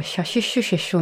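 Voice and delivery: さしすせそ softened to "sha shi shu she sho". Retold or imitated. Speaker imitating Korean learners of Japanese, sh for s. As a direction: turn every s into sh, シャシュシュシェショ. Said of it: "さしすせその発音が苦手で".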